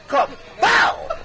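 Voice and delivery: mock punch noise shouted; shouted smack sound effect. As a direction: bark out the smack like a cartoon hit.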